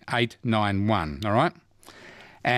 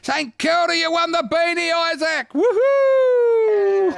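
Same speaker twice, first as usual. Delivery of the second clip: very high, excited winner announcement; game host going wild for the winner; jubilant celebration; 5 dB louder, slower than usual. Real, not acted. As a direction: cheer the winner, rising to a loud whoop. Host celebrates caller's win.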